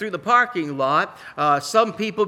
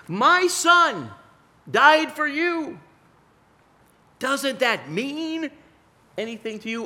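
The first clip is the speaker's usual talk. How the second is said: God pleading; 10 semitones higher, 4 dB louder, slower than usual. Pretend. God asking his people whether his son's death matters, pleading. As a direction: plead as a grieving father. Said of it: "what God wants to say to us".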